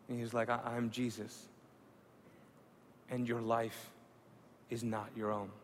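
hushed awe. Real, not acted. Awed hushed vision retelling.

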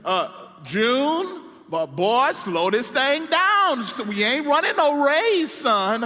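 a hint of stern granddad, drawn out; granddaddy slowing him. Preacher voicing granddaddy telling grandson to slow down, slow and stern. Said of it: "He said".

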